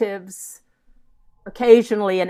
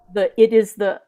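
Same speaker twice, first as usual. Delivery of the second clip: ordinary delivery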